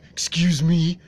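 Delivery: high, loud offended squawk; offended Pompous. Acted. Pompous offended at being called a dropkick, high and loud. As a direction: squawk it in outrage.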